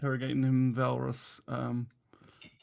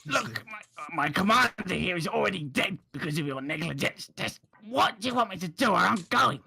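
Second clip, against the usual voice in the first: lightly voiced, protesting captive; a hint of an indignant soldier; aggrieved captive; 6 semitones higher than usual. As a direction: complain in character, pitch jumping up at times. Game master voices an aggrieved captive.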